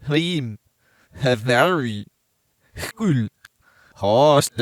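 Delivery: reversed backwards speech. Shown as a demonstration. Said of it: "say it backwards and then reverse it".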